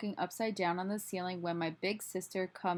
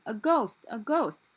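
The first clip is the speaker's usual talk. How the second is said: high, loud cry of alarm; storyteller's raised crowd shout; panicked crowd yelling; 4 semitones higher, 6 dB louder, slower than usual. A narrator's reading. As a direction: yell a warning in a panic. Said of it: "with everybody yelling".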